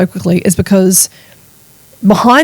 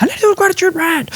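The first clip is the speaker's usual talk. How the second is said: high, fast put-on voice of her cranky self; mock frazzled self; 11 semitones higher, 3 dB quieter, faster than usual. To listeners, mimicking her grumpy self, high quick squeak. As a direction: squeak it out like you've hit your limit. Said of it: "then I'm like".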